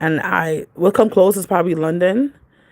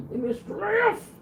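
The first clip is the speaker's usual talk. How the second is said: high, slow drawn-out plea; praying for patience through teeth; 8 dB quieter, much slower than usual. Real, not acted. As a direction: stretch it out, begging. Exasperated with child, praying.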